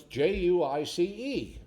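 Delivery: slow, letter-by-letter spelling. Acted. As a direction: spell it out slowly, one letter at a time. Joke character spells a word aloud.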